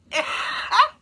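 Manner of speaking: high burst of real laughter; loud laugh. Real, not acted. Laughing at her own joke with viewers, loud high laugh.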